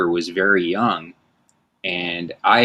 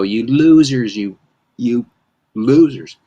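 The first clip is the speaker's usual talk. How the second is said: mock yell; 3 semitones higher, 4 dB louder than usual. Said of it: "So I would just go".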